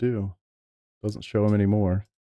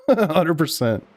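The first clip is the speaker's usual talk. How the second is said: laughing agreement; 6 semitones higher, much slower than usual. Real, not acted.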